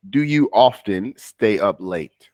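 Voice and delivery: slow, careful repeat of the question. Shown as a demonstration. Teacher repeating the question slowly for a student. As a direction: spell it out slowly for the learner. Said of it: "can you repeat it?".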